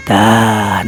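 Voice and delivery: ordinary delivery, nothing marked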